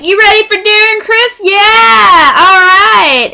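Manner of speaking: loud, high crowd-rousing call; mock stage announcer firing up the crowd; imitated hype shout. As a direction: holler it high to a concert audience. Opener hypes crowd for [name].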